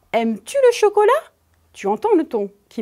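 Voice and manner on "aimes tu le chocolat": «Aimes-tu le chocolat?» pitched high and quick, climbing to the end; rising question tune. Shown as a demonstration. Teacher demonstrating the rising question tone to a pupil. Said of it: "écoute bien quand je dis".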